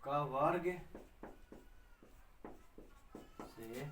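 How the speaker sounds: slow, spaced dictation with long pauses; dictating a rule to students, slow and spaced. Shown as a demonstration. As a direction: give it word by word for writing down. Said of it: "लिखिए".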